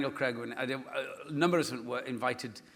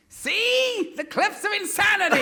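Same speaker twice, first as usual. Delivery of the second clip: put-on high loud child shout; high, loud kid voice; imitating six-year-old; 8 dB louder, slower than usual. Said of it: "my six-year-old has started going".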